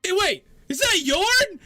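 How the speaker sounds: high, loud shout from across the room; a reader behind a bookshelf spots Yorn and calls out high and loud; distant surprised call. Acted. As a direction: call out in surprise from far back. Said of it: "behind a bookshelf go".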